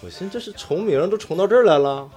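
usual speaking voice

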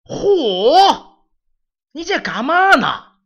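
put-on old man calling loudly into the room; elderly servant hollering through the door. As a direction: call out to the boy, loud and slow. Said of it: "老莫爷就开始往里嚷".

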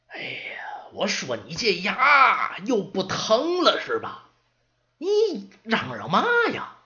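put-on whispered sigh, then slow, high teasing old man; grumpy old servant. Old servant teasing the yelling apprentice, slow mock scolding. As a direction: needle the noisy kid like a cranky old-timer.

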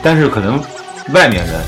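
ordinary delivery

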